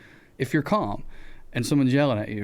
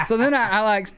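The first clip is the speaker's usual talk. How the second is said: laughing; 6 semitones higher than usual. Real, not acted. Laughing while telling.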